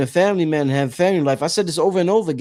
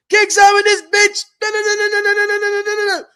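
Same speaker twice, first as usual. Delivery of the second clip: much higher, squeaky mocking rant voice; mock high-pitched rant. Retelling a call to King Simon, in a mock squeaky rant. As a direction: imitate his own younger self venting, comically high. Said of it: "I've called King Simon like".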